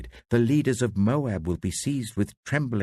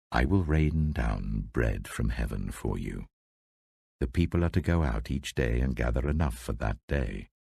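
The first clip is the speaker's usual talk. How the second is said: lower, solemn voice of God; voice of the Lord; 6 semitones lower than usual. A narrator's reading. The Lord speaks to the Israelites, lower and solemn. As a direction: read the Lord's promise lower and grave.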